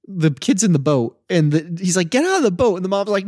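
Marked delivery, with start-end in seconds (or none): 2.1-2.7 a raised, higher mock shout to get out; urgent yell. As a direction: bark the order like a scared dad. Brody yelling at son in boat.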